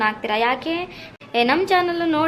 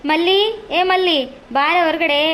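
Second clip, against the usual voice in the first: loud, raised call to a neighbour; summoning shout, pitched up at the name; calling out loudly; 3 semitones higher, 4 dB louder, slower than usual. Acted. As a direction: holler for someone indoors to come out. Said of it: "ಅದ್ಯಾಕ್ ಬೇ ಅಷ್ಟ್ ಕೂಗಾಡ್ತೀಯ".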